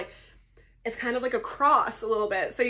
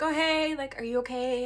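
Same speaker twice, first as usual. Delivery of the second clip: higher, lilting nurse voice; imitating the nurse; 5 semitones higher than usual. Nurse checking on waking patient, imitated in higher voice. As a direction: lift it into a gentle check-in.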